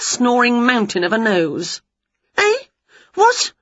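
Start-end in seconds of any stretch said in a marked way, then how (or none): 2.4-3.5 high startled eh what; jolted awake, confused; startled waking. A narrator's reading. Winnie woken by the wand, high startled. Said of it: "said Winnie, sitting up".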